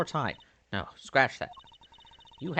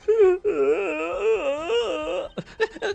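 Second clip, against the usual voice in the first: high wailing cry; 5 dB louder than usual. Acted. Trucy crying loudly.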